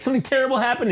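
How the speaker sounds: high, timid woman's voice; mock secretary. Secretary entering the boss's office, high mock voice. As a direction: squeak it like a nervous secretary.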